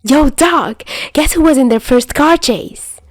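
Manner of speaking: barge in eager to tell; excited greeting. Acted. Albatross bursting into the clinic to tell Doc, high excited call.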